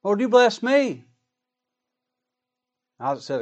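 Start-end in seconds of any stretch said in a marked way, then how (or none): 0.0-1.0 high, loud begging plea to a father; bitter pleading cry. Acted. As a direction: beg desperately, voice pitched up and pushed loud. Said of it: "he cried with a great and exceeding bitter cry".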